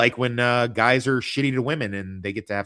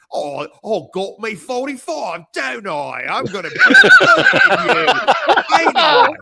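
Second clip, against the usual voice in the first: hammed-up high Cockney, getting louder; horrible Cockney accent; 13 semitones higher, 11 dB louder than usual. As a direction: do a terribly fake Cockney threat. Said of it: "in that horrible Cockney accent".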